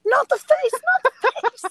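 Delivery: very high, loud mock yelp; panicked plea. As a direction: plead in a comic high-pitched panic. Attacked man covers his face.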